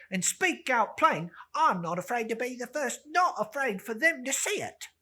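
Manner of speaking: loud, high, fast and bold; brash charwoman. Acted. Charwoman thrusting her bundle at old Joe, loud and bold. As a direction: push Joe to name his price.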